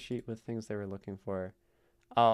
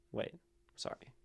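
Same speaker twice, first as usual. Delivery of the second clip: usual speaking voice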